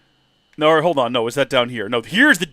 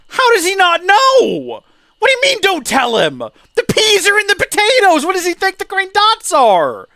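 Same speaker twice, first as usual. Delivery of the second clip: baffled outrage; 9 dB louder than usual. Retold or imitated. A five-year-old baffled that nobody sees the peas, high outraged squawk. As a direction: shriek it like a flabbergasted kid.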